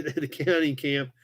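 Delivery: usual speaking voice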